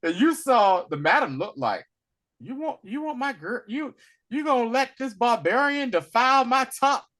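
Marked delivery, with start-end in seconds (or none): 2.4-7.1 high-pitched mock indignation; affronted. Madam objecting to a barbarian client, indignant and high. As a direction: play a scandalized madam, pitched up.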